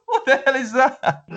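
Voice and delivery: high-pitched, imitated baffled reaction; laughing disbelief. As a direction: crack up while quoting the question. Retelling laughing reaction to accent.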